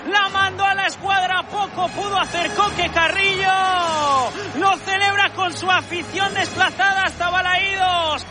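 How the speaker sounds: shouted, high, fast goal call; breakneck goal commentary. Real, not acted. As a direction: scream the goal to the stadium. Goal scored in live match.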